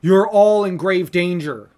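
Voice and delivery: loud, drawn-out alarmed warning; mock panic. Zordon warns the Rangers of grave danger, mock panicked. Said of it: "He's panicking. He's going".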